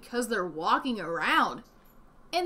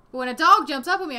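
ordinary delivery

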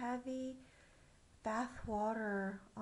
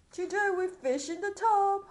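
jolly king declaring; 7 semitones higher, 10 dB louder than usual. Acted.

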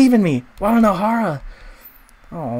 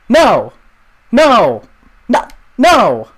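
shouting no; 3 semitones higher, 9 dB louder, much slower than usual. Real, not acted. Reacting to betrayal.

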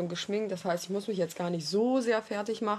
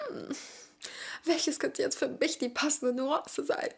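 pondering ad voice; 5 semitones higher, 4 dB quieter, slower than usual. Shown as a demonstration. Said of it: "da müssten wir erst da so sitzen".

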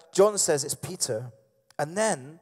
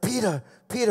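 calling for attention; 6 semitones higher than usual. Acted. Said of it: "and he's going".

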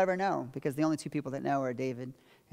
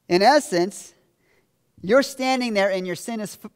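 usual speaking voice

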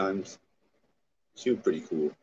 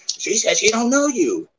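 mocking voice; 14 semitones higher, 5 dB louder than usual. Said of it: "And then you're like".

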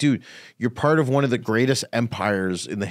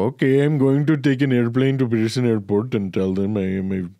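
mock immigrant voice; 2 semitones higher than usual. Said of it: "They just go".

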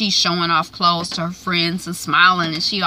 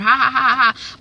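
mock laugh; 6 semitones higher than usual. [name] joking with his dad.

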